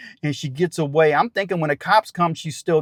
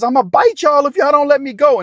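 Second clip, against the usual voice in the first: high, louder angry threat; imitated threat; 8 semitones higher, 4 dB louder than usual. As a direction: snarl it out as the struggling woman.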